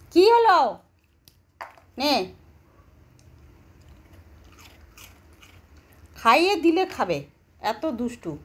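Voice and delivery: high, loud sing-song coaxing of a sulky pet. Real, not acted. Owner coaxing her sulking pet to come eat, high sing-song baby talk. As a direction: sweet-talk the sulking little one into coming to eat.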